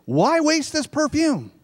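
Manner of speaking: scorn the wasted perfume; indignant. Acted. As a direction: disciples scolding the woman, high and indignant. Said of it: "saying indignantly".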